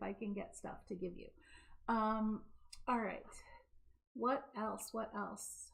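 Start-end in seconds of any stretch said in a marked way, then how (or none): 4.9-5.7 softer muttered repeat; muttered to self. Real, not acted. Thinking aloud to herself, muttered softer. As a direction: mutter it under your breath.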